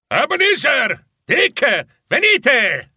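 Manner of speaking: high, drawn-out call; shouted call. A narrator's reading. Old master summoning his two apprentices, calling out. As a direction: call out loudly across the room. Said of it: "gridò".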